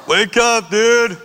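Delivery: loud, high, pushy call to get up; rowdy wake-up call. Retold or imitated. Bert hollering at the door at dawn, high and loud. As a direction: bang on the door and holler him awake.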